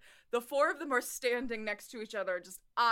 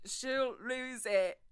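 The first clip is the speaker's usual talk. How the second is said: softer, faltering try at Cockney; attempted Cockney accent; 6 dB quieter, slower than usual. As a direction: repeat it, hunting for the Cockney accent. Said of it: "That's my accent".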